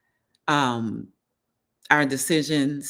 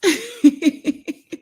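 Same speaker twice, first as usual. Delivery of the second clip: high, loud giggling laugh; laughing at the lurkers in the chat; high giggle; 14 semitones higher, 6 dB louder than usual. Real, not acted. Laughing at people in the bushes.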